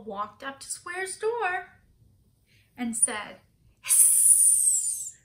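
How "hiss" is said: long unvoiced hiss; snake hiss. A narrator's reading. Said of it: "just like a snake".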